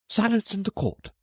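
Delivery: high herald's cry of Silence; cried out. A narrator's reading. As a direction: call the court to order.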